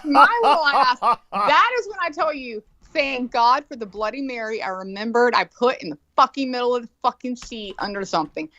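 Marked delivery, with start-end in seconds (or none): none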